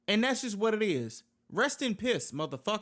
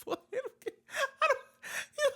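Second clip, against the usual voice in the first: breaking into incredulous laughter, much higher; laughing in disbelief; 7 semitones higher, slower than usual. Real, not acted. Laughing at parents who dislike YouTubers, incredulous. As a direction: laugh it out, can't believe it.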